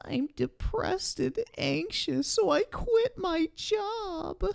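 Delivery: high, slow whiny mimicry; mock them in a whiny voice. Mocking the donor's message.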